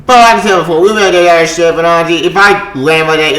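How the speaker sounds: normal talking voice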